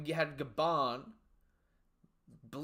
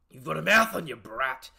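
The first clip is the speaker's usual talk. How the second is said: taunting villain; 10 dB louder than usual. Acted. Reading a villain's line aloud.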